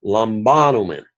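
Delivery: "Lambanomen" accented on "ba", third syllable from the end; antepenult stress. Shown as a demonstration. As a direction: punch the "ba" in "Lambanomen". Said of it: "back to the antepenult".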